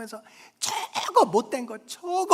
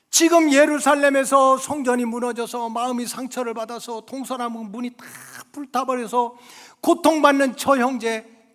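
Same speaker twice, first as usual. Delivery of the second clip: normal talking voice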